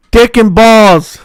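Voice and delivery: loud slow prank yell; slow scream. Pretend. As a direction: stretch it out as a prank shout. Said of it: "I'm just screaming that".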